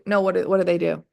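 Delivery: ordinary talk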